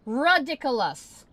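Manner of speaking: loud, slow, drawn-out shout of disgust; Ridiculous yelled loud and slow; furious yell. Real, not acted. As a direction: blow up at the screen with the word. Frustrated streamer yelling at site.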